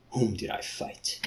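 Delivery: whispered, soft; whispered question. Acted. Leopard man asking softly, whispered. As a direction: breathe the question. Creature voice unvoiced.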